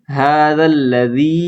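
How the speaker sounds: usual speaking voice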